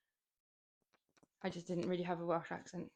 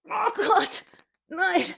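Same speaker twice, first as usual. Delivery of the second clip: much higher and louder, forced out with effort; real exertion on the final count; straining at the limit; 8 semitones higher, 9 dB louder than usual. Real, not acted. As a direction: cry out as the last rep nearly beats you. Last reps of a hard set.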